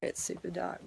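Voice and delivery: usual speaking voice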